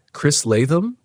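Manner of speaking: high, questioning repeat of a name; testing the sound. A narrator's reading. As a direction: try the name out, rising as if unsure. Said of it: "like testing the sound".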